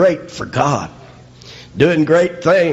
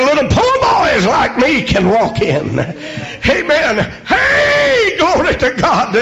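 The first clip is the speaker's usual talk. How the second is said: tearful preaching shout; 11 semitones higher than usual. Acted. Preacher crying out to the congregation, tearful shout. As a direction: shout it like a tearful altar call.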